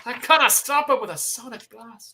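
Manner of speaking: put-on Banshee's Irish accent. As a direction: keep the hammy Irish accent going. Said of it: "you gotta do the Irish".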